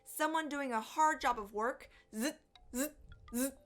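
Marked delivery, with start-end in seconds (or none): 2.1-3.5 short buzzing zzz strokes; sawing noise. Acted. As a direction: imitate a saw, stroke by stroke. Imitates sawing work.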